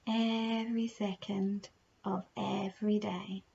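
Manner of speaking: slow, soft meditation voice with unhurried, drawn-out phrases; guided meditation. Acted. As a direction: gently guide the listener through their breathing, leaving long pauses.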